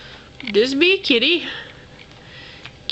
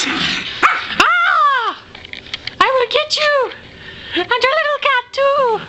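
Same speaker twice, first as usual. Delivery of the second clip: high, hammed-up villain shriek; cartoon villain threat; 9 semitones higher than usual. Toy threatens the owner and her cat, hammed-up high villain voice.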